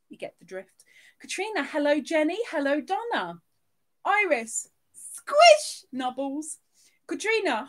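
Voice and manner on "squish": high and loud squeak; squeaky squish. Pretend. Streamer squeaking the squish emoji to Iris. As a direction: squeeze the word out like a toy.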